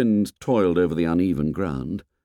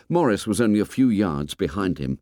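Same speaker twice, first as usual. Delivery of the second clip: plain reading voice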